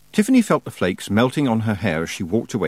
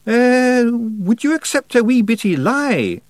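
high, loud Scots character voice; little rogue angling to get away with a fib; wheedling wee Feegle; 6 dB louder, slower than usual. A narrator's reading. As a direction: slowly try it on in a high Scots voice. Rob's character voice in dialogue.

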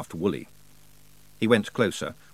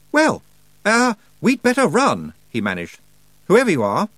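put-on higher, loud flustered young man; nervous boy; 10 semitones higher, 7 dB louder than usual. Roland flustered in front of the strange girl. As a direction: stammer out a plan in alarm.

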